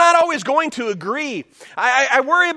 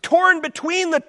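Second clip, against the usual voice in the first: urgent Paul; 7 semitones higher than usual. Retold or imitated. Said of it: "Paul's saying".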